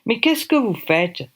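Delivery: put-on botched English accent, slowed down; failed first try at an English accent; thick English accent. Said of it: "un accent anglish à couper au couteau".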